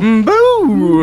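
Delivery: high loud wailing boo; scary ghost boo. Pretend. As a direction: moan then wail like a ghost. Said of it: "a scary ghost".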